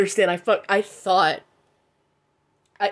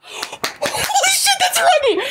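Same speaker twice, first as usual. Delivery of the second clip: gasp, then a high, laughing shout; real shriek of joy, much higher and louder; overjoyed shout; 5 dB louder, slower than usual. Real, not acted. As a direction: explode with delighted disbelief. Gacha pull reveals wanted card.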